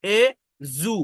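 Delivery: এ জু said slowly, with a clear j sound; teacher sounding out syllables. Shown as a demonstration. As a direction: break it into এ and জু. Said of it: "এই D থ্রিটা হচ্ছে জ sound".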